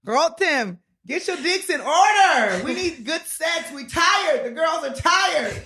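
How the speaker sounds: high, hammed-up pleading, bursting into a shout; mock rabbi begging, pitched high; playful imitation of a rabbi pleading for his people; imitating a rabbi's plea. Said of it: "He said".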